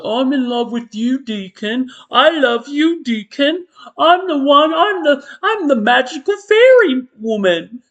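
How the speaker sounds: high, breathy lovesick swoon; mocking lovesick villain. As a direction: gush over Deacon like a lovestruck fairy. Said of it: "It's like".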